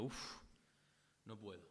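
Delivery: deflated sigh. Retold or imitated. Defeated exhale after new year.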